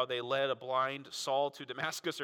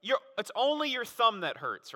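normal talking voice

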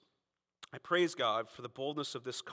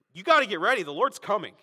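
loud urgent street call; fervent shout to the crowd; shouted warning; 6 semitones higher, 12 dB louder than usual. Retold or imitated. Preacher voicing street evangelist.

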